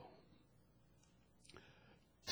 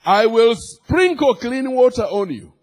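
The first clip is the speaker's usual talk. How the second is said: raised, emphatic pulpit declarations; full-force preaching; 7 semitones higher, 6 dB louder than usual. Real, not acted. Preacher telling congregation God is taking over, forceful preaching. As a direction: drive each phrase home to the crowd.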